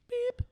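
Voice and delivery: high, short electronic beep; mouth beep. Acted. [name] voices the hand scanner's beep, high and short. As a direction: chirp it like a scanner. Imitates the security device.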